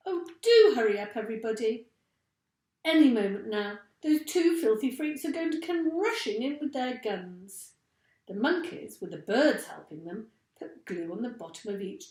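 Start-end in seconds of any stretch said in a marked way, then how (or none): 0.1-7.7 urgent. A narrator's reading. Mugglewump urging again.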